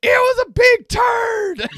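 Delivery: put-on high, loud, drawn-out kid holler; slow high-pitched mock scream; imitated child's proud shout. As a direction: announce it like a triumphant little girl. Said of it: "She says".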